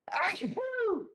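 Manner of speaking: a real high achoo; sneeze. Real, not acted. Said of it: "the sneezing came on".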